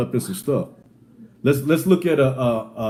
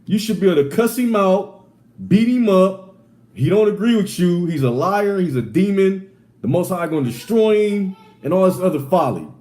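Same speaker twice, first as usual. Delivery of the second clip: mock fired-up advice; 7 semitones higher than usual. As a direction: play the hothead egging him on, tongue in cheek. Mock advice, then he corrects it.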